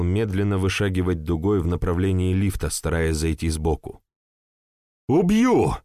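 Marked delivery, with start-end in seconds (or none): 5.1-5.8 high, loud furious outburst; blurted threat. Acted. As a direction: spit out the threat in rage. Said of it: "выпалил Ян".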